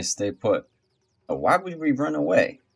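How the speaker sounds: ordinary talk, nothing marked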